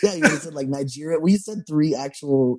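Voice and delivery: defensive. Real, not acted. Defending himself, voice rising.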